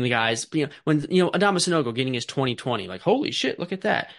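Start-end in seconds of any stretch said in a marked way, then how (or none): 3.0-4.1 sudden high-pitched disbelief; amazed exclamation. Retold or imitated. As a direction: react like a stunned fan. Imitating an amazed viewer.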